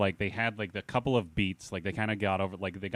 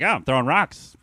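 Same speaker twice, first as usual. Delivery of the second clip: quoting Donnie; 6 semitones higher, 6 dB louder than usual. Retold or imitated. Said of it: "the first thing he says is just like".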